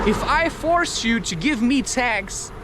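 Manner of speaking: normal talking voice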